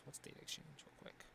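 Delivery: quick muttered whisper to himself; whispered aside. Real, not acted. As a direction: breathe it under your breath, quickly. Reading to himself.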